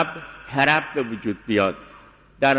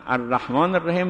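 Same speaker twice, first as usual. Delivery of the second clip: ordinary delivery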